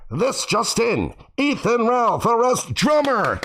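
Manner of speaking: put-on slow, high old-timey newsreel announcer; 1950s narrator. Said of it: "old-timey newsreel week in reviews".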